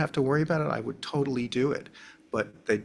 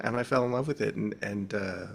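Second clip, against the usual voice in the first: ordinary delivery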